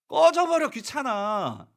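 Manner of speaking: loud high snap, then flat annoyance; snapping get lost. Retold or imitated. Children telling the prophet to get lost, snapping. Acted rude dismissal.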